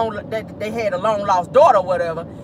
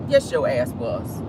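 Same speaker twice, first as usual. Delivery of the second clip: ordinary talk, nothing marked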